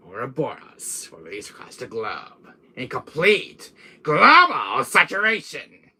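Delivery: slow, grandiose villain swelling to loud peaks; drawn-out, theatrical world-domination speech; Wesker. Acted. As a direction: proclaim it like a megalomaniac villain. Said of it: "I like my Wesker voice".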